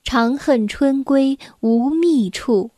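slow, measured classical verse reading; poem recitation. Acted. Storyteller reads a Tang poem to children, slow deliberate recitation. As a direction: recite slowly, with pauses between phrases.

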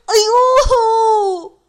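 loud high cry of pain; pained yelp. Acted. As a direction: yelp as the punch lands. Punched in the eye.

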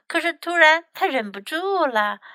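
plain reading voice

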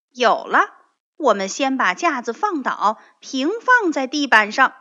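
sudden bright idea. Acted. Rabbit gets an idea.

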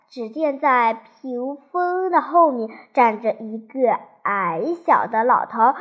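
ordinary delivery, nothing marked

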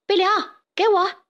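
high-pitched call for the ball. Acted. Player calls to teammate mid-match, calling out high.

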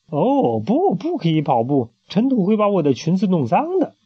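lightly voiced spoiled queen; fussy queen. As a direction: fuss over your dress like a spoiled queen.

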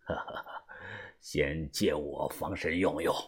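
low, slow, quiet chuckling boss; soft chuckle. A narrator's reading. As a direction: chuckle faintly, then speak unhurriedly. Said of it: "他看着我笑了". (低声轻笑着说)

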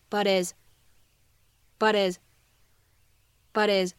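"but is" with the h dropped, run together; linked but his. Shown as a demonstration. 老师示范连读 "but is"，省略h. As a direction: say "but is" as one word, no h. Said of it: "我们呢可以读成".

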